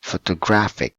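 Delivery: "photographic" punched loud on "graph"; stress on third syllable. Shown as a demonstration. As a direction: hit "graph" hard in "photographic". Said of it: "the third one is".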